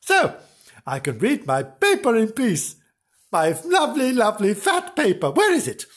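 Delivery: high-pitched contented eagerness; cheerful character, drawing out the treats; cheering up. Acted. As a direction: relish the good news, pitch lifted. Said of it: "cheering up".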